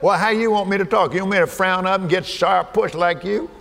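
normal talking voice

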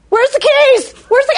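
high, loud flustered squeal; hammed-up panic hunting for the keys; mock panic. Teen at the typewriter, squealing in mock panic. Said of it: "I was like".

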